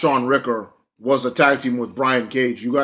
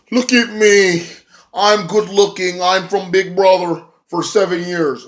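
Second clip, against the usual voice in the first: higher, slow, preening show-off; mock vain brag; 7 semitones higher, 3 dB louder, slower than usual. Reality star bragging to the crowd, higher and slow. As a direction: play a vain pretty boy admiring himself. Jesse Godderz quoted bragging.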